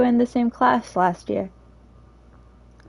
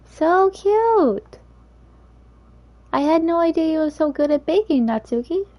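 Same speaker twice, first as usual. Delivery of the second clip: put-on gushing girl voice; 7 semitones higher than usual. Girl delighted by cupcakes.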